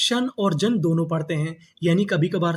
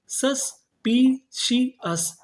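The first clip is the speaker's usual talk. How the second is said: Suspicious drawn out very slowly, higher, falling at the end; slow syllable by syllable; 5 semitones higher, much slower than usual. Shown as a demonstration. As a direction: sound out each syllable slowly. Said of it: "तो meaning क्या बनी". (धीरे-धीरे, ऊँचे स्वर में)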